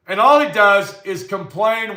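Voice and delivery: ordinary delivery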